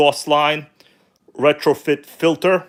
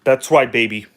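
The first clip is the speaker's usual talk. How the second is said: ordinary talk, nothing marked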